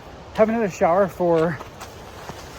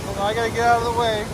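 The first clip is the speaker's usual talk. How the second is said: excited; 6 semitones higher than usual. Real, not acted. Crossing a stream, jumping rocks.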